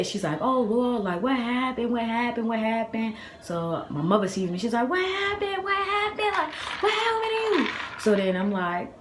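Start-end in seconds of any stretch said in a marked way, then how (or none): 0.4-3.1 higher-pitched, repeated anxious questions; mimicking grandma's worried fussing; imitated worried grandmother. 4.9-7.7 very high, squealed panicked questions; hammed-up high frantic mom voice; imitated alarmed mother. Imitates mother alarmed at crying child.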